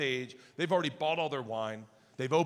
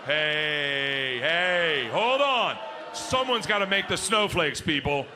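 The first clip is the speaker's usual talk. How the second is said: coach calling out; 4 dB louder, much slower than usual. Retold or imitated. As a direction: stop everyone like a sports coach.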